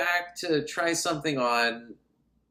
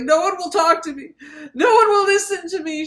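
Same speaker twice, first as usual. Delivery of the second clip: high, loud, hammed-up meltdown; mock freak-out; 13 semitones higher, 6 dB louder than usual. Ignored woman shaking people for attention, high mock meltdown. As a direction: wail it way up high, imitating panic. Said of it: "She's freaking out".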